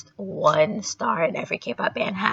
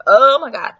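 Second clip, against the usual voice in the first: loud raised Oh my god; startled loud gasp; excited exclaim; 6 semitones higher, 12 dB louder than usual. Retold or imitated. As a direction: blurt it out excitedly. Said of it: "And I was like".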